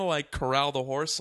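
ordinary delivery

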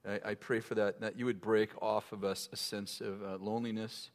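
soft earnest prayer voice. Acted. Pastor praying over the singles, soft prayer. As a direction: pray it low and quiet.

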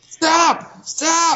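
high, hammed-up shout; high mock yell of stop; mock cry to stop. Tug of war at Denny's.

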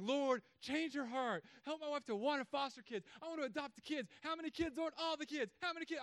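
high, eager pleading to the Lord; mock-excited prayer for kids; cheerleader prayer.

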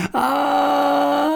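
very high drawn-out scream; a long fleeing cry. Acted. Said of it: "sie riefen auch langgezogen". (langgezogener hoher Schrei beim Flüchten)

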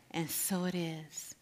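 soft breathy closing amen; whispered amen. Acted. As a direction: seal it with a whisper.

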